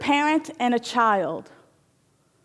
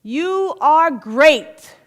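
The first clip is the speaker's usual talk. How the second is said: slow drawn-out yell; echoed praise; 4 semitones higher, 3 dB louder, much slower than usual. Acted. Mountain echoing praise, slow and loud. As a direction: stretch it out like an echo. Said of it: "yells back the mountain".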